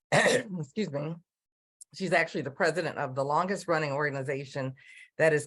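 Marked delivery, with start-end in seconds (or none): 0.1-1.1 hoarse, clearing her throat. Real, not acted. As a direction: push through a hoarse voice. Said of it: "I'm not sure why I'm losing my voice".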